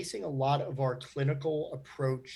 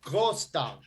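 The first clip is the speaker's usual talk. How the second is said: mock German accent; 4 dB louder than usual. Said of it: "Are you talking in".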